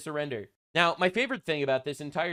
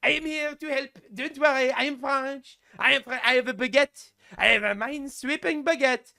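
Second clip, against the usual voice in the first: high, hammed-up French accent, louder; cartoon French accent with a pitched-up voice; mock French accent; 8 semitones higher, 4 dB louder than usual. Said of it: "Macron's like".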